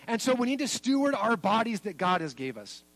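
ordinary talk

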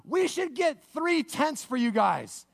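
eager, high-pitched blurt; high, drawn-out clueless outburst. Acted. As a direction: blurt it out slowly and high, overeager. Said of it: "blurts out".

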